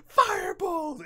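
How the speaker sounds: very high, loud battle shout; yelled spell. Acted. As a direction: scream the spell name at full force. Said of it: "Amelia yells, "Firebolt".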